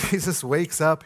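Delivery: normal talking voice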